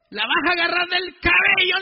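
retold furious priest giving orders; furious command. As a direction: bark the order at the gang leader. Priest retells ordering gang leader.

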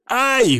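sharp high pained cry; yelp of pain. Acted. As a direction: cry out from a sudden bite. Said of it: "вскрикнул он".

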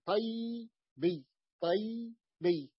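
"طيبي" very slow, split tay... biyy; still ya demo. Shown as a demonstration. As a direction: break "طيبي" after a long quiescent y. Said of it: "وتبقى الياء ساكنة". (نطق "طيبي" مقطعا ببطء بياء ساكنة)

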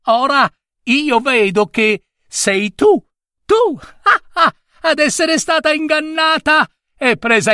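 gleeful mocking squirrel voice; taunting demon squirrel. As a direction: crow down at the trapped fox from a treetop. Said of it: "gridò alla Volpe Stria".

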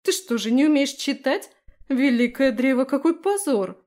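high, loud scandalized jeer; loud scorn. A narrator's reading. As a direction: shame her loudly. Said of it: "громко фыркнула она".

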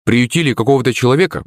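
usual speaking voice

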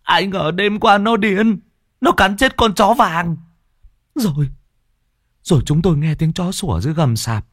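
high-pitched, anxious woman's voice; high panicky wife recounting the attack; frightened village woman. Acted. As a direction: tell the horror breathlessly, frightened. Woman's voice in the story.

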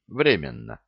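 ordinary delivery, nothing marked

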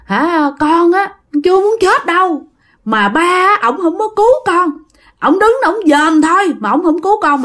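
the dead son, through the possessed woman, reproaching his mother about his father; reproachful ghost son. Acted. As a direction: whine a blame at the father, pitched high. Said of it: "cô này bắt đầu trách móc".

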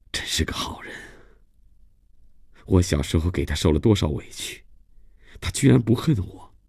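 whispered wonder, rising in pitch at the end; moved inner thought. Acted. Christophe touched by an old schoolmate's kindness, hushed inner thought. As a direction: think it aloud, hushed and touched. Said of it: "他心里想".

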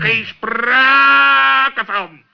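out of the question, said slow and loud; firm refusal. Acted. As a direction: drag the refusal out firmly. Flat refusal of extra bedroom.

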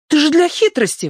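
high-pitched excited protest; exclaiming. A narrator's reading. Proshka objects to Notka's confession, high exclamation. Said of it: "воскликнула Прошка".

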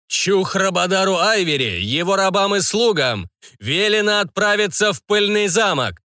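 high, booming magical proclamation; magically amplified voice. A narrator's reading. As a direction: thunder it like an enchanted herald. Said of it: "прогремел голос, усиленный магией". (голос, усиленный магией)

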